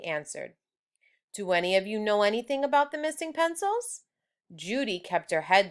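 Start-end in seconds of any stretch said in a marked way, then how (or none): 1.3-4.0 higher, faster teacher asking; quick suspicious question. Acted. Teacher quizzing the class about missing pencils, quick.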